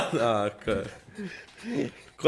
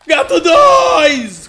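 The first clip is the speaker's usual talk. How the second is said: drawn-out yell of the name; long shout; 13 semitones higher, 13 dB louder, much slower than usual. Real, not acted. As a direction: call out his name slowly and very loud. Friend in danger, calling out.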